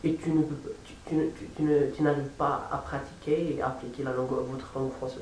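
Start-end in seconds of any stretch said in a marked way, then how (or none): none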